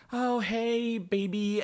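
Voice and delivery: high, drawn-out cooing hello; girlfriend's sweet greeting. Retold or imitated. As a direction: imitate a sugary greeting to a boyfriend.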